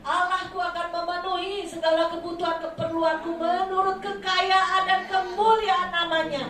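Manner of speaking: loud, high preaching proclamation; preacher leading the congregation in confession; faith declaration. Shown as a demonstration. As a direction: declare it boldly at full force. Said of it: "Saudara ucapkan".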